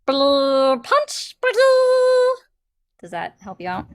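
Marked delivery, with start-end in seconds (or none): 0.0-2.3 high, loud cartoon alien voice; Lilo and Stitch character. Streamer quoting a Disney film as a clue, high cartoon creature. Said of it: "yeah, uh, Lilo and Stitch".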